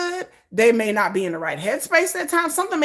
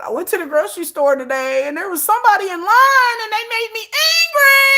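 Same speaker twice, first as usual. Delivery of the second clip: high, loud, whiny mock complaint; mock whiny young person; 10 semitones higher, 5 dB louder than usual. Mocking a complaining young person, high loud whine. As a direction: whine it like a melodramatic vlogger.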